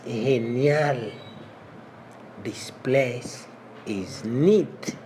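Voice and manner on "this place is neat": slow and drawn out; slow model phrase. Shown as a demonstration. Teacher slowly modeling the phrase for learners. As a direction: stretch each word for the class. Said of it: "slow model phrase".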